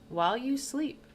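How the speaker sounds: normal talking voice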